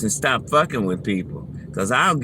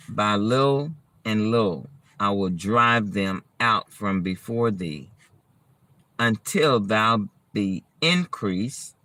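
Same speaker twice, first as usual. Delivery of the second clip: scripture reading; 4 dB quieter than usual. Acted. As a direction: read it like scripture. Reads Exodus passage aloud.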